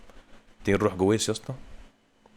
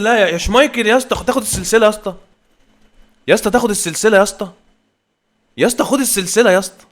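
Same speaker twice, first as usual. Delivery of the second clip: higher, louder begging the keeper; mock pleading shout; 9 semitones higher, 9 dB louder than usual. Real, not acted. Gamer jokingly begging Schmeichel to take bribes, loud pleading. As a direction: beg him to let one in, half joking.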